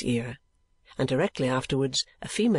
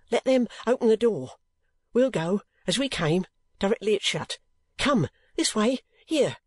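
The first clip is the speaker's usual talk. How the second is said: higher, hurried whispered urging; urgent old Newman; 7 semitones higher than usual. A narrator's reading. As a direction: hustle him out the back. Newman drags Nicholas away urgently.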